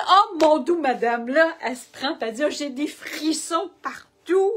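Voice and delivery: loud and high with amazement; waitress overwhelmed with excitement; amazed. Retold or imitated. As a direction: gush like an awestruck waitress. Waitress amazed by psychic news.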